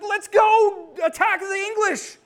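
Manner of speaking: loud, high, slow shout; fired-up shout. Retold or imitated. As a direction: yell it like you're charging. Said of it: "You're like".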